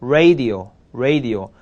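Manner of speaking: "radio" said twice, tongue not touching the palate; slow careful "radio", r without tongue contact; pronunciation demo. Shown as a demonstration. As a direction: model "radio" with a free-floating r. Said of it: "혓바닥이 입천장에 닿지 않습니다".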